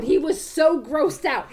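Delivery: ordinary talk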